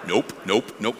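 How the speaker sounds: low, soft repeated denial; dismissive refusal. Retold or imitated. Thomas told Jesus is alive, quietly dismissive. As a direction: murmur each refusal, unbudging.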